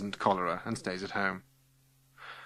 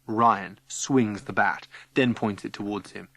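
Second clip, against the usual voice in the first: ordinary delivery, nothing marked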